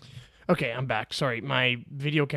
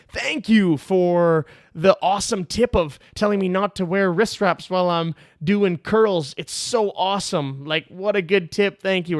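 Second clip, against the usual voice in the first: higher, slow, gushing mock gratitude; sarcastic thanks; 5 semitones higher, 7 dB louder than usual. Lifter tagging his critic in a story, sarcastically grateful. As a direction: lay on fake thanks, drawing it out.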